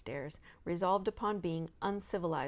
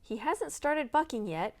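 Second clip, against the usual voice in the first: higher, pleased with herself; proudly; 8 semitones higher, 4 dB louder than usual. A narrator's reading. Proud cousin bragging on the horse. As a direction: boast it brightly. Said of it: "said Annabel proudly".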